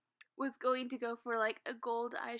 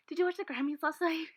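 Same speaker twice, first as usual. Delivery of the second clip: higher and louder, a nosy busybody; high nosy lady voice; 6 semitones higher, 6 dB louder, faster than usual. Retold or imitated. Lady asks the waiter.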